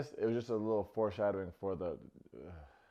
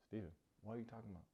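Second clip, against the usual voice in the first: fast quote; 13 dB quieter, much faster than usual. Retold or imitated. Monica's quote, fast and soft.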